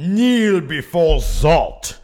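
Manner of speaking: slow, booming evil overlord; villain voice. Pretend. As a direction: command them to kneel like a tyrant. Mock villain command.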